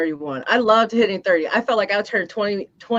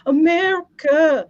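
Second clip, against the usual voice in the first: drawn-out, higher movie-quote voice; quoting Cole; 7 semitones higher, much slower than usual. Retold or imitated. Friend quoting the movie, slow and higher. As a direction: stretch it like the film line. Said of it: "Cole when he was saying".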